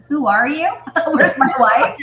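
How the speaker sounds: playful imitating of her amazement, with a laugh; lightly voiced astonished wife; mock surprise. Husband imitating wife's reaction at the massage, playful mock surprise. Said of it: "You're like".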